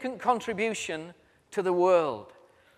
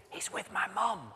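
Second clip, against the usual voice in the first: small hushed whisper; hushed boy; 12 dB quieter than usual. Retold or imitated. Boy whispers to his dad's boss on the phone. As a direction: keep it secret-quiet like a hiding child.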